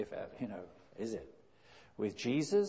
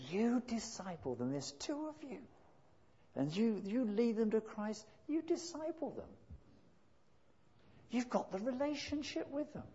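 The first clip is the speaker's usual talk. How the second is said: emphatic urging; 11 semitones higher than usual. Retold or imitated. Retold firm urging to friend.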